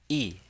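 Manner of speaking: yi falling sharply from high; fourth tone. Shown as a demonstration. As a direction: drop "yi" quickly from the top. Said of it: "These are the four tones".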